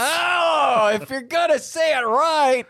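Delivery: mocking. Mocking the other speaker.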